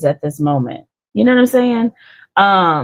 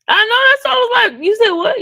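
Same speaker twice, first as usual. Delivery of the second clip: amused teasing; faster than usual. Real, not acted. Teasing her friend's garbled words.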